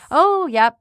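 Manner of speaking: a breezy brush-off, lightly mocked; dismissive. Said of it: "boys get this rap".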